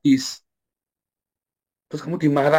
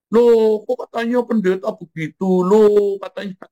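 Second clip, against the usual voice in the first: high, loud, scandalised "Loh" and then a gossiping question; mock shocked onlookers; 9 semitones higher, 3 dB louder, slower than usual. People judging a pastor caught sinning, mock scandalised. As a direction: play the gossip who catches the pastor out.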